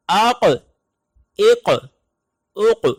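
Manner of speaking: tajweed qalqala practice; qalqala drill. Shown as a demonstration. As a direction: drill the echoing qaf on each vowel. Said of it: "اس کی آواز ہوگی".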